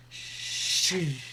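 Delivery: slow hissed sh like a building cymbal roll; cymbal swell. Shown as a demonstration. Teacher imitates a cymbal roll, a slow hissed sh. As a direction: stretch the sh out.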